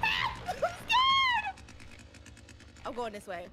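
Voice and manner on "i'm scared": scared shriek. Real, not acted. Fleeing the enemy in game.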